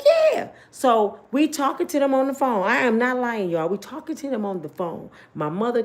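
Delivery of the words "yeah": very high, loud eager yeah; eager yes. Retold or imitated. Naive girls answering brother, squealing eager yes. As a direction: squeal it like a hopeful teen.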